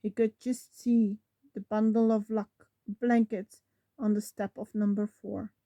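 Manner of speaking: usual speaking voice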